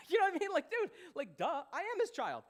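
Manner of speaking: high, loud, laughing disbelief; incredulous. Acted. Son mocking his own doubt about his dad, incredulous. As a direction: blurt it out as glaringly obvious.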